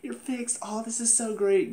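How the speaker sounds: high, softened mock-delighted gushing; light, high-voiced fake delight; mock happy. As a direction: imitate a thrilled girl, pitched well up. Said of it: "she's all, like, happy to see him".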